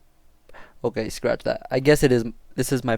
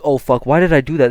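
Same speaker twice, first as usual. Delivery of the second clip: loud mock panic; retold freak-out; 8 dB louder, faster than usual. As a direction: blurt it loudly in regret. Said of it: "I'm freaking out".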